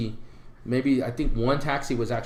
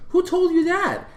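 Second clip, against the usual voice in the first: surprised; slower than usual. Retold or imitated. Security guard surprised.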